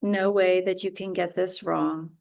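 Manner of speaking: ordinary talk, nothing marked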